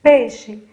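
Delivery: Peixe called out loud; word said out clearly. Shown as a demonstration. Teacher to pupils, naming peixe loudly. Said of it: "Vamos agora pra palavra peixe".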